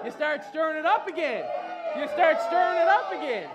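loud, high declaring; shouted preaching. Real, not acted. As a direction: drive it home like a storm. Preaching at full force.

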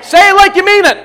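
high, loud exhortation; rousing shout. Real, not acted. Preacher urging everyone to say it, rousing shout. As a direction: push the crowd to mean it. Urging congregation to louder response.

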